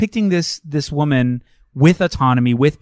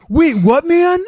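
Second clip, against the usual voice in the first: hammed-up bewildered bad actor, high and loud; Keanu Reeves; 12 semitones higher, 4 dB louder, slower than usual. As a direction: stammer in mock bafflement. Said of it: "when he's so bad".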